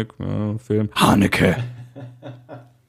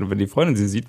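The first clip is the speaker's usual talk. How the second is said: ordinary delivery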